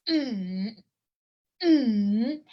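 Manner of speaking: "mm" hummed down and then up; third tone. Shown as a demonstration. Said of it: "You go down and up".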